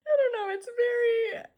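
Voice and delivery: very high, half-crying laugh; overcome, voice squeaks up; teary giggle. Real, not acted. Fan overwhelmed by a picture, squeaky teary laugh.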